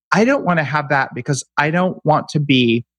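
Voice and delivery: high, fast whiny complaining. Retold or imitated. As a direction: whine like a fearful purist. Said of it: "How come they're a girl".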